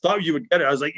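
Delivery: normal talking voice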